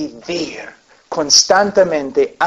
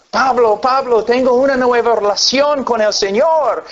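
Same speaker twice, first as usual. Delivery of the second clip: a lightly raised, eager voice for the new convert; eager new believer; 6 semitones higher than usual. Retold or imitated. A pastor imitating a convert eagerly claiming a new walk, lightly voiced. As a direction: play the excited convert who thinks he has changed.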